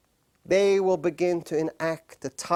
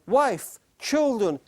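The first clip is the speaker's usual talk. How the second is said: usual speaking voice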